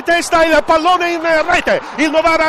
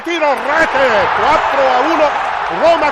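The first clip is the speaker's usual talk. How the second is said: breathless italian goal commentary; 3 dB louder than usual. Real, not acted. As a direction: drag out the score, thrilled. Goal called out.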